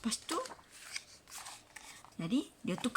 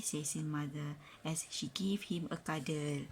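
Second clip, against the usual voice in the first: whispered narration; 4 semitones lower, 7 dB quieter than usual. A narrator's reading. Soft narration.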